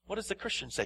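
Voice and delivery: normal talking voice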